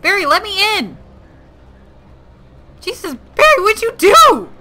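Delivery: high, loud shouting at a locked-out friend; real panicked yell. Real, not acted. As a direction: bang on the door and scream for Barry. Locked out, yelling at Barry.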